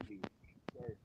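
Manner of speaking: usual speaking voice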